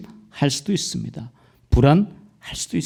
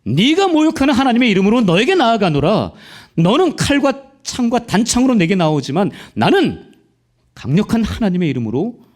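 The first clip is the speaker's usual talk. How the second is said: high, loud defiant battle cry; David's bold challenge; 8 semitones higher, 5 dB louder than usual. Retold or imitated. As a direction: proclaim it to Goliath like David facing him. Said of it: "다윗처럼". (골리앗에게 외치는 다윗처럼 높고 담대하게)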